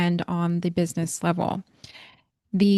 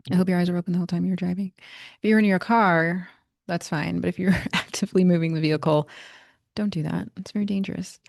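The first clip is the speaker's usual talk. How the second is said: ordinary talk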